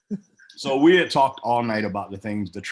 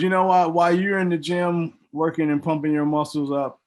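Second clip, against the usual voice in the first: higher, louder mocking jab; taunting; 6 semitones higher, 4 dB louder than usual. Partner taunting him on stage, higher and louder mockery. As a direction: sneer the setup of the insult.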